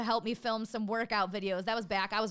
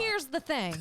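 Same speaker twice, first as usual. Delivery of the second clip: light teasing mimicry, slightly higher; Tiger; 5 semitones higher, slower than usual. Mimicking the friend's catchphrase to him, light teasing impression. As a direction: echo his catchphrase back at him. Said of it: "That's what he said".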